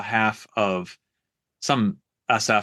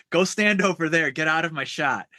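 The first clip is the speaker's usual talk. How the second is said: higher, hammed-up commanding bark; a higher mock-angry officer telling a guy off; mock Oliver North yell; 6 semitones higher than usual. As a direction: play the colonel shoving someone out of the shot. Oliver North shoving SF guy away.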